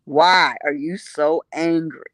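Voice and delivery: "Why are you so angry?" slow and low; slow taunting question. Retold or imitated. Speaker needling the angry room, slowly and low. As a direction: draw it out calmly to needle them. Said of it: "I was asking them".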